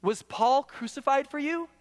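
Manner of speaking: slow, high rhetorical question; drawn-out sarcastic query. Acted. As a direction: ask it as a pointed taunt. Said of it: "It's sarcasm".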